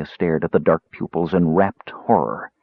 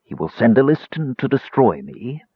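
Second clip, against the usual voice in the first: ordinary delivery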